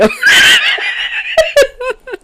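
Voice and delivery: high shrieking burst of laughter; a loud, high laugh bursting out. Real, not acted. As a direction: squeal with laughter at the screen. Laughing at scary guy.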